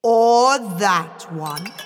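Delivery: slow regal Frost King. Acted. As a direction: pronounce it slowly, with cold authority.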